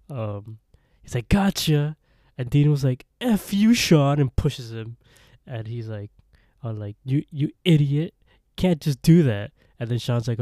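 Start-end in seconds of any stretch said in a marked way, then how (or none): 1.3-1.9 loud, higher mock yell; jump-scare shout, acted out; retold scream. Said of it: "screaming at him". 3.2-4.2 loud, high indignant yell; acted-out startled anger; mock angry outburst. Retold quarrel after a scare. 7.0-9.5 loud, higher retort with a stammered start; acted-out angry telling-off; mock indignant scolding. Retold quarrel after a scare.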